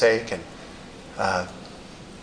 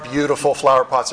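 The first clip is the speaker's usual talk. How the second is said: ordinary talk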